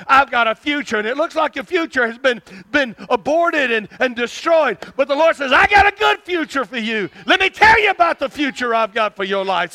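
loud, urgent proclamation; full-force prophetic preaching. Acted. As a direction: pour out the promise, peaking in a shout. Prophesies in the Lord's words.

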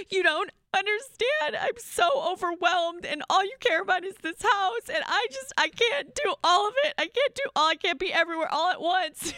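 wife sobbing her frustration at her husband, high and strained; tearful meltdown. Retold or imitated. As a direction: replay the breakdown, voice cracking near tears. Retold meltdown, crying to husband.

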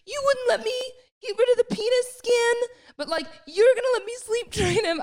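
very high, tearful mock whine; imitated crying. Mum retelling her upset call to Fran, mock crying. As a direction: wail it like you're crying, hammed up. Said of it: "when you're crying".

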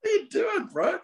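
high-pitched giggling through the words; laughing so hard the voice cracks up high; helpless laughter. Real, not acted. Scolding the cat while laughing helplessly. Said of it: "this cat's driving me nuts".

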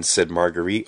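ordinary delivery, nothing marked

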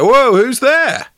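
high, loud mock startle; feigned surprise. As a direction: stretch out a hammed-up "whoa". Hero feigns surprise at unseen voice.

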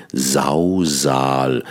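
"Sausal" with both voiced s buzzing and stress on Sau; alliteration demo. Shown as a demonstration. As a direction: lean on the matching s sounds of "Sausal". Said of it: "eine Alliteration".

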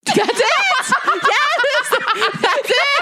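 high delighted shout through laughter; excited laughing. Real, not acted. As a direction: burst out laughing, yes. Recognizing the Goblin King outfit.

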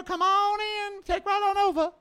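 sarcastically sweet come-on-in voice; mock welcoming host. Mocking those who let the Taliban walk in, high and sing-song.

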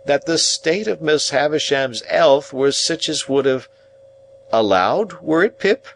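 high, rustic Joe reciting carefully; laboured formal message in a simple man's voice; effortful remembering. A narrator's reading. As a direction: recite it bit by bit, then check. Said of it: "like another effort of remembrance".

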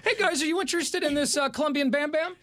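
high, eager mock seller; naive dealer voice. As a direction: pitch it like a clueless tourist. Mock naive seller pitching cocaine.

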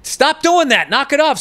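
high-pitched scolding call across the mesh; retold calling out. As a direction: re-enact telling a kid off, high but not shouted. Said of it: "I'm on the mesh thing going".

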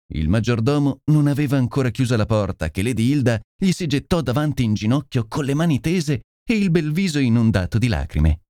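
ordinary delivery

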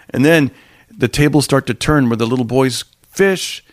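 normal talking voice

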